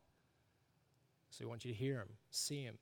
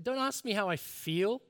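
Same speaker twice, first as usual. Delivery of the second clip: retold indignant retort, pitched up; blunt honesty; 5 semitones higher, 3 dB louder than usual. Preacher retells a grumpy reply to the host, indignant. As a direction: imitate someone fed up with being asked. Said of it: "He's like".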